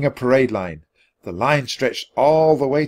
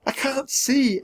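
lightly voiced high child; much higher, small girl's voice; little girl; slower than usual. A narrator's reading. Annie tells Jack she cannot see, girlish.

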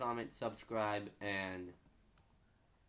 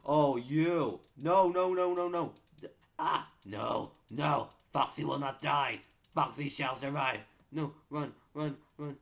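high loud panic cries; panicked shouting; 5 semitones higher, 6 dB louder than usual. Real, not acted. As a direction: yell in real alarm. Attacked in game, panicking.

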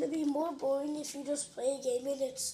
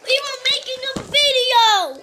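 angry shout; 11 semitones higher, 19 dB louder than usual. Real, not acted. Yelling at a noisy brother.

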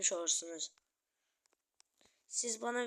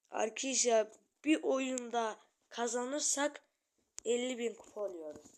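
ordinary delivery

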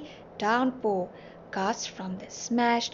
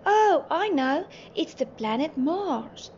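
high, slow start settling as it explains; slow, pleased explanation; eager explaining; 6 semitones higher, 3 dB louder, slower than usual. A narrator's reading. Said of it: "Timmy explained".

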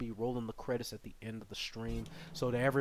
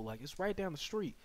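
ordinary talk, nothing marked

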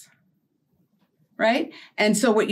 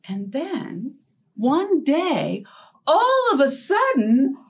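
lively storybook read with big pauses; reading to children; 5 semitones higher than usual. Acted. Storyteller reading a shape story to young children. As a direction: draw the kids in, tale by tale.

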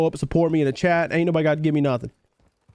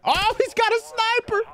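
sudden high-pitched squeal of alarm; panicked gamer squeak; alarmed yelp. Real, not acted. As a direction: yelp as you spot danger. Spots a sniper in the game.

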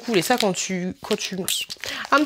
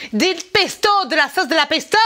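put-on Italian accent; 4 semitones higher than usual. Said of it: "avec un accent italien".

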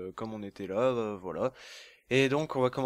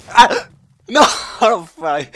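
loud outraged cry; outraged yell; 8 semitones higher, 14 dB louder than usual. Real, not acted. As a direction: yell at the killer. Killed in game.

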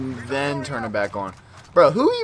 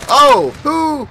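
sudden high yelp of surprise; startled shout; 12 semitones higher, 10 dB louder than usual. Real, not acted. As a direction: jump at the enemy popping out. Startled by enemy.

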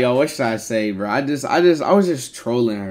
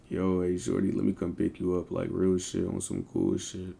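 low, soft phone-flirting voice; put-on deep voice; 5 semitones lower, 9 dB quieter than usual.

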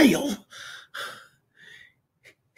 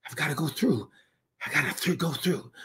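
much softer, near whisper; hushed; 4 semitones lower, 12 dB quieter, much faster than usual. Real, not acted. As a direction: let it sink to almost nothing. Falling to near silence.

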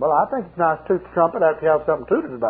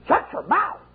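much higher angry demon retort; snapping demon; 11 semitones higher, slower than usual. Retold or imitated. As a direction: bite back as the demon. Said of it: "He said".

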